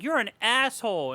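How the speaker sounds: high mock shriek; mock angry yell. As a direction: snap it like a hurt girl. Vera yelling at Shelly.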